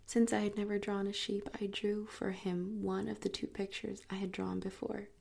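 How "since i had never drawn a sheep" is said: ordinary delivery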